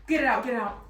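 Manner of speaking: loud, urgent mock panic; hammed-up plea to get a bug off you; frantic demand. Retells panic over bee.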